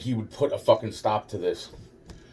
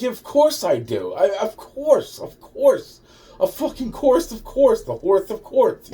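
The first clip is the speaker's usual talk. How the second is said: much higher, slow, sing-song sarcasm; sarcastic mock confession; 9 semitones higher, 4 dB louder than usual. Answering the feds' absurd question with hammed-up sarcasm, high and sing-song. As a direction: drag out "of course" like it's absurd.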